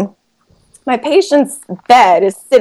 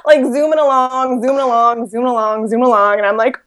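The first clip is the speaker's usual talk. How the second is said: ordinary talk, nothing marked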